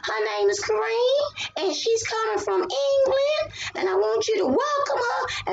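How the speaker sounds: high, sweet teacher's announcement. Retold or imitated. Teacher introduces the new student to the class, high and sweet. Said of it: "she was like".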